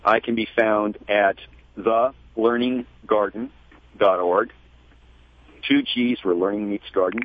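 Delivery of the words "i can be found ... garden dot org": deliberate, word-by-word web address; careful dictation with pauses; slow and clear. Real, not acted. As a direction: give it out slowly for listeners to note. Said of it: "say it slowly and clearly".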